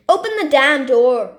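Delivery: loud furious order; angry shout. Acted. Man at the front door, loud angry shout. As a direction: demand it with rage.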